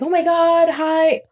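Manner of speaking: high, bubbly greeting; lightly voiced tipsy coworker; mock drunk gushing. Imitating a drunk boss greeting David.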